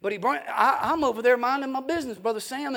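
ordinary talk, nothing marked

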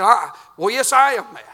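loud, high imitation of an angry lady; mad lady. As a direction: snap back at the pastor, mock indignant. Voicing the angry church lady.

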